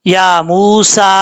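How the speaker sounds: मूसा with the long ू held for one alif; long vowel. Shown as a demonstration. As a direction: stretch the vowel in मूसा slowly. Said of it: "एक अलिफ की मकदार लंबा करेंगे". (मूसा का ू एक अलिफ लंबा)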